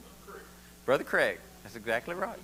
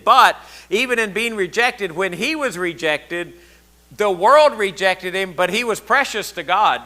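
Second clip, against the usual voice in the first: louder full-voiced sermon build; preaching; 2 semitones higher, 8 dB louder than usual. Preacher urging the youth to crave Jesus, rising sermon. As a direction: drive the message home to the congregation.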